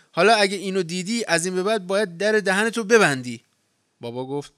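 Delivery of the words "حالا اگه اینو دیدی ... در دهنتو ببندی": loud, higher shouting at him; angry yell. Acted. Harry yelling at Papa after the plumb line, angry yell. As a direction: bellow it like the proof settles everything. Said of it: "هاری سر او داد زد".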